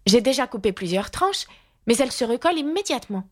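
put-on high girl's voice, speeding up at the end; exasperated little girl. A narrator's reading. Alice complaining to the lion, exasperated and high. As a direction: complain well above the narration, hurrying it out.

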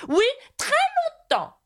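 high-pitched, earnest knight voice; extremely serious. A narrator's reading. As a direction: insist solemnly in a high character voice. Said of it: "d'un ton extrêmement sérieux". (chevalier très sérieux, voix haut perchée)

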